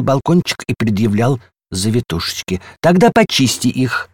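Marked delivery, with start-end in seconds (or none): 2.8-4.1 raised-pitch storybook command; bossy order. A narrator's reading. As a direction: give orders in a higher voice. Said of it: "распоряжался горшок с геранью". (повелительно, повышенным голосом)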